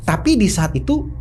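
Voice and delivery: normal talking voice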